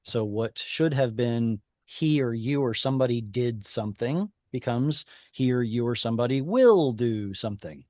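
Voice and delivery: ordinary talk, nothing marked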